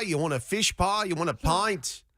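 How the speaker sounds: mock Cockney offering food and drink; British accent. Host jokes with co-hosts in a British accent. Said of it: "Rhett is way better at this accent than I am".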